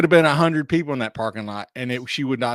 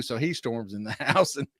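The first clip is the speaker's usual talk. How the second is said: ordinary delivery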